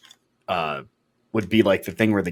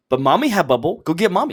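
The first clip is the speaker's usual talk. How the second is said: put-on toddler voice; 9 semitones higher than usual. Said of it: "He looked at me and goes".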